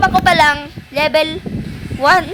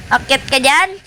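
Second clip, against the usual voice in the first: ordinary talk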